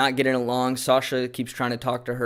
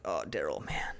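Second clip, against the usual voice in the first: sympathetic sigh; 8 semitones lower, 10 dB quieter than usual. Retold or imitated. Reacting to Daryl's crying scene.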